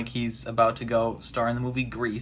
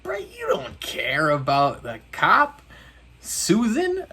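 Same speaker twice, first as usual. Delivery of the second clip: incredulous call-out; 5 semitones higher than usual. Retold or imitated. Calling out a character.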